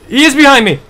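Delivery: terrified shout. Real, not acted. Monster right behind him.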